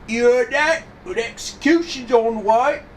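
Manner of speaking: high, loud heckler's cry; excited courtroom onlooker. Acted. Onlooker spreading news of an execution, loud excited cry. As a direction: call it out high and loud to the crowd.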